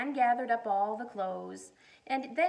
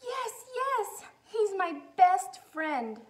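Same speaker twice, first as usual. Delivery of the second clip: high, loud and slow; joyful shout; 11 semitones higher, 8 dB louder, slower than usual. Acted. Lisa finds Corduroy, joyful loud shout. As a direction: cry out with joy. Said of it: "shouted Lisa".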